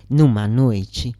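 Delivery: ordinary delivery, nothing marked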